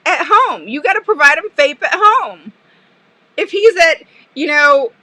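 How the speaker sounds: loud, high, emphatic insisting; retold insistence. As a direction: hammer the point home to the director. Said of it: "you guys cracked up at me when I said this".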